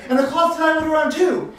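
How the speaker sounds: high, loud excited blurt; panicked chiming in. Acted. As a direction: pile on to a frantic tale. Draper adds to the panicked tale.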